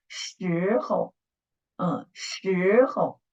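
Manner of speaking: 时候 with a long rising 时 and a short, light 候; slow teacher's model of 时候, stressed on 时; first-syllable stress. Shown as a demonstration. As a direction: draw out 时 in 时候, then drop 候 lightly. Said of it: "make an emphasis of the first syllable".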